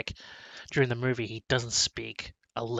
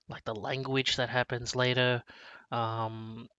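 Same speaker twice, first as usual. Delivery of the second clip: ordinary delivery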